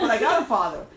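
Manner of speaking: laughed out, loud and amused; laughing protest. Retold or imitated. The child answering God, laughing loudly. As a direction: blurt it through a laugh.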